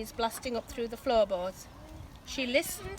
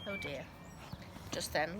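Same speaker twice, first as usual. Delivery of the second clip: ordinary delivery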